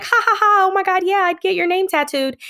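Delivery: high-pitched, giggly imitation of flirty texting; mock flirting. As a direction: gush playfully with a put-on laugh. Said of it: "when you're just flirting".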